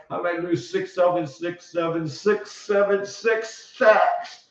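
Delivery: whiny panicked tennis player voice; a hammed-up fretting Murray; mock Murray. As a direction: moan like a doomed player about to lose. Mocking Murray's panicked self-talk.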